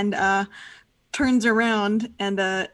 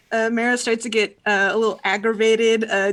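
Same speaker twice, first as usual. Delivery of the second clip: ordinary delivery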